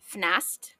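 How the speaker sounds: "fnast" with F running straight into N; slow "fnast", both F and N sounded; F-N cluster. Shown as a demonstration. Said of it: "pronounced like F plus N".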